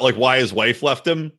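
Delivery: ordinary talk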